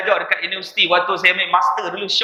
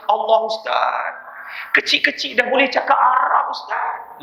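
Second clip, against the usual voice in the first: high, amazed auntie voice, trailing to a breath; awed old auntie; 5 semitones higher than usual. Retold or imitated. Auntie tells the ustaz she's amazed, in a high awed voice. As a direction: gush like a moved auntie. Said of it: "Saya kata / makcik".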